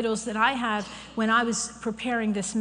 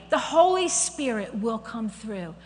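ordinary talk, nothing marked

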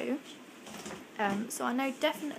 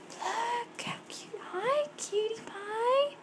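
soft cooing whisper; 10 semitones higher, 4 dB quieter, slower than usual. Real, not acted. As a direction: coo it gently at a tiny animal. Cooing at bush baby.

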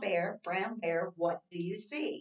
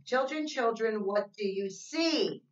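sing-song read-aloud, slow and even; reading to children; 4 semitones higher, 4 dB louder, slower than usual. Acted. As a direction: read the rhyme to little kids, slowly. Reads picture book.

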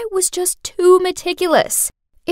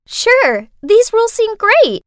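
simpering; 8 semitones higher, 4 dB louder than usual. A narrator's reading. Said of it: "Hazel fluttered her eyelashes and said".

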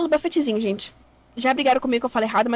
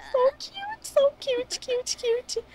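much higher cooing squeak in English; squeaky cute voice; 12 semitones higher, much slower than usual. Real, not acted. Gamer cooing at a dancing Sims couple in a squeaky voice.

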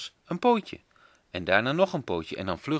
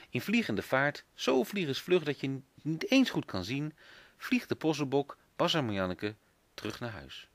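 ordinary delivery

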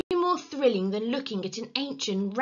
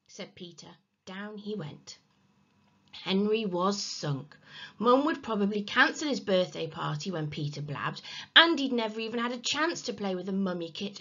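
ordinary delivery, nothing marked

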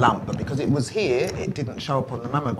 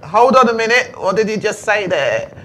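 much higher and louder, stunned disbelief; shocked disbelief; 9 semitones higher, 7 dB louder than usual. Retold or imitated. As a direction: blurt it out as if the news just landed. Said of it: "That's how they said".

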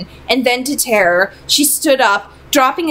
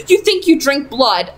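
plain reading voice

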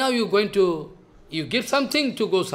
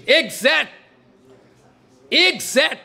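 exact repeated higher and louder; stress on exact; 6 semitones higher, 5 dB louder, slower than usual. Real, not acted. Preacher to his listeners, insisting the teaching is exact, hammered stress. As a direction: hammer exact home twice, louder each time. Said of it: "repeats the word for emphasis".

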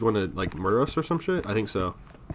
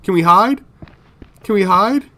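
urgent high, loud question; panicked; 10 semitones higher, 9 dB louder than usual. Real, not acted. Player to the game as danger comes, panicked. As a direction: call it out high in a sudden panic.

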